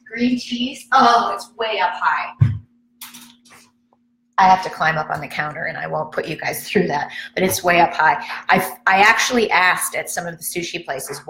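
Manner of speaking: ordinary delivery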